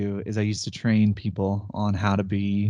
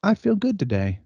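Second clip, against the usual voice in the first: upbeat inner voice; 6 semitones higher than usual. Retold or imitated. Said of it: "Your brain just starts to be like".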